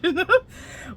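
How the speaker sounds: a real, rising burst of laughter; quick delighted laugh; amused laugh. Real, not acted. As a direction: laugh out loud at the joke. Laughs at the comeback.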